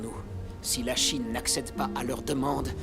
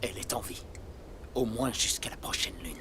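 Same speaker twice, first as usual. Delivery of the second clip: whispering; 3 semitones lower, 6 dB quieter than usual. Acted. Grim news told in hushed voice.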